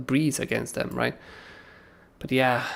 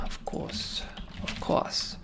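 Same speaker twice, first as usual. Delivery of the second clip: breathy whispered of course to himself; hushed muttered aside; 10 dB quieter, much slower than usual. Real, not acted. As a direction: whisper it under your breath. Muttering to himself while scrolling.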